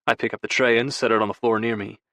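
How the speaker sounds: ordinary delivery, nothing marked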